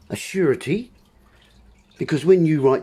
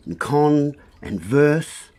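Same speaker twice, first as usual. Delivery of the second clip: slow word split; much slower than usual. Shown as a demonstration. Splits converse into words.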